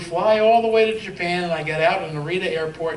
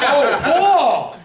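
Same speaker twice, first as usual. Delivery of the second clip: high, loud calling out; real yell; 9 semitones higher, 9 dB louder than usual. Real, not acted. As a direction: holler to someone who can't hear. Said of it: "If you yell, he still can't hear you".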